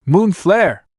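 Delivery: high shouted attack name; battle cry. Acted. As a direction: yell the spell out.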